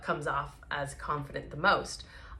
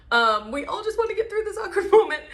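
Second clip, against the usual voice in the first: laughing; 13 semitones higher, 6 dB louder, faster than usual. Real, not acted. Laughing about awkward moment.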